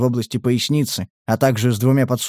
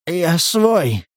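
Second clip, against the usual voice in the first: higher, strained plea; strangled whisper; 5 semitones higher than usual. A narrator's reading. Pinned prisoner claims to be friendly, strangled whisper. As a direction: squeeze it out under a heavy foot. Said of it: "сдавленно прошептал пленник".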